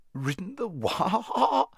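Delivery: high neighing cry on a drawn-out WHA; indignant horse neighing the words; horse's neigh. A narrator's reading. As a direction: let WHA break into a long whinny. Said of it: "came out in a sort of neigh".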